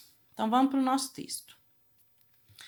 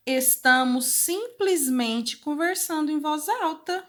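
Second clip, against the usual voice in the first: surprised; 6 semitones higher, 3 dB louder, slower than usual. Acted.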